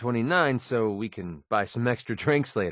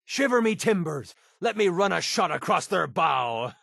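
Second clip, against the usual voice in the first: high, hammed-up pirate voice; mock pirate; 6 semitones higher than usual. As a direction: play a silly pirate, pitched high. Mock pirate talk in a game.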